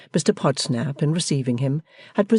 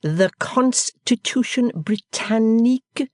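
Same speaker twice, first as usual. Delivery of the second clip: slow, much higher, sing-song teaching; host explaining to foreigner as to infants; infant school teacher; 9 semitones higher, 4 dB louder, much slower than usual. Acted. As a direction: speak as to tiny children. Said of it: "as if he were teaching in an infant school".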